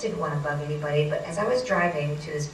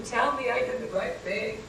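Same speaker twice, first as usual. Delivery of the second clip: higher, strained mock scream held back in volume; 4 semitones higher than usual. Driver begging psychic friend for reassurance, mock scream. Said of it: "I'm screaming".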